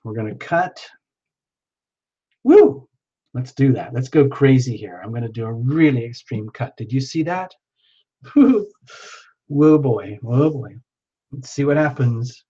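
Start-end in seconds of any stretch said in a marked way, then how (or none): 2.4-2.9 high, loud "woo"; excited whoop. Real, not acted. Reader cutting cards for a viewer, excited high whoop. 8.4-8.7 high, loud laughing "woo"; excited whoop. Real, not acted. Reader after an extreme cut, high excited whoop.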